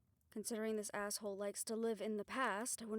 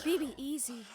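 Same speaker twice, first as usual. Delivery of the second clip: loud, urgent steadying call; 4 semitones higher, 7 dB louder than usual. Acted. Friend steadies B B as she falls, urgent call. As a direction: urge a falling friend to hold on.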